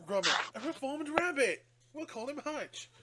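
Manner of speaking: high, chirpy Wallace impression. Wallace telling Gromit about the rabbit, chirpy and high. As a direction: beam it like a proud inventor. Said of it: "and he goes".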